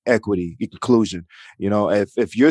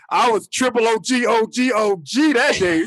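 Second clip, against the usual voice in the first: higher, hyped-up bragging; mock boast; 12 semitones higher than usual. Real, not acted. Brother bragging to his sisters, hammed-up boast. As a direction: puff up like a triple OG. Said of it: "boasting about being gangster".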